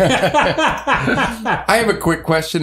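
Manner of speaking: laughing while talking; words broken by laughter. Real, not acted.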